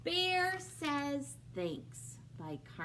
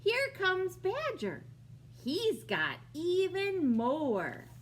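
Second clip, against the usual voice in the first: lightly voiced Hare; 7 semitones higher, 5 dB louder, slower than usual.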